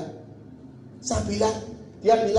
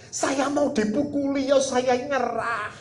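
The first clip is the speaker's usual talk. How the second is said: mock-crying surrender, rising in pitch; tearful pleading; 6 semitones higher than usual. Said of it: "Dia nangis". (menirukan orang memohon sambil menangis)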